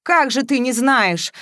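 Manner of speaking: exasperated disbelief; bursting in. A narrator's reading. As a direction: burst in with a heated question. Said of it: "ворвался в женский туалет Матвеев".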